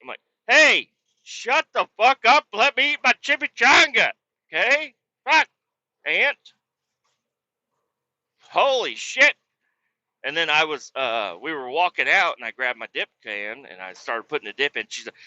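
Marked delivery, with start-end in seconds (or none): 0.5-9.3 loud, high mock outburst; exasperated yelling. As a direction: yell it high and drawn out at the aunt. Acted outburst at chatty aunt.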